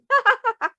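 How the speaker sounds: high, loud laugh; laughing along with the joke; burst of laughter. Acted. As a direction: laugh like the giggling kids. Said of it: "fell on the floor laughing".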